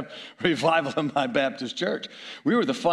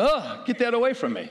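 disgusted retort; 8 semitones higher than usual. Retold or imitated. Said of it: "I said".

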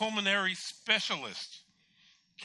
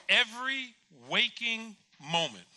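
EVERY, WAKING, MOMENT each hit separately; slow, loud and high, pausing between "Every waking moment"; emphatic command; 6 semitones higher, 5 dB louder, slower than usual. Retold or imitated. God instructing the patient, "Every waking moment" hammered out slowly.

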